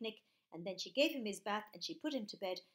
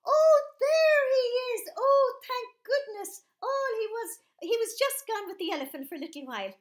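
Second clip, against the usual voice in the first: relieved mum; 14 semitones higher, 13 dB louder than usual. Acted. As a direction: cry out with relief, almost sobbing. Mum relieved, baby found.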